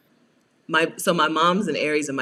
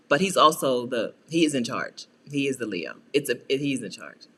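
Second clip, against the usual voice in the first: normal talking voice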